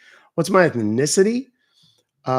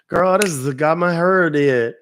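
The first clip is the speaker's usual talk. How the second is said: put-on country accent; 3 semitones higher, 5 dB louder than usual. Jokes in country voice about hair.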